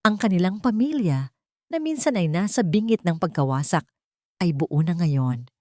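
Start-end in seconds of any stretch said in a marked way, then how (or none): none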